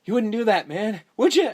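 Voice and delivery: mildly voiced nervous plea; Carlos quote. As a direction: beg him not to do it. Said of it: "And Carlos is like".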